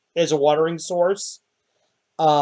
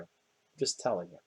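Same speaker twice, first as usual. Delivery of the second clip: quiet repeat; 11 semitones lower, 18 dB quieter than usual. Acted. NPC repeating his warning to the ranger, softly murmured. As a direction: repeat it much softer and lower, almost under the breath.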